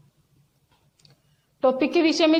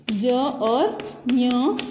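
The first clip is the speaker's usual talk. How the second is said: ja and nya said very slowly; slow letter naming; much slower than usual. Shown as a demonstration. Teacher naming the two letters slowly. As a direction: spell ज और ञ out slowly. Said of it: "ज्यों में कौन से वर्ण हैं".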